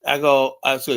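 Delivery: ordinary talk, nothing marked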